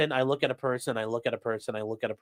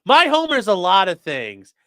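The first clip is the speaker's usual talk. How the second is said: loud, raised-pitch mock declaration; Grandpa Simpson; 4 semitones higher, 8 dB louder than usual. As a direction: proclaim it loudly as a cartoon quote. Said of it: "Grandpa Simpson's like".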